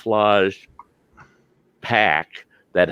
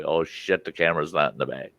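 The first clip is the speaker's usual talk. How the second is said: quiet dismayed realization; 4 semitones lower, 7 dB quieter than usual. Retold or imitated. Inner thought realizing camera missing.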